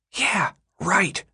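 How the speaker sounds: breathy unvoiced whisper; a boy whispering in a daze. A narrator's reading. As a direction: whisper it, high and doubtful. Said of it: "Chuck whispered".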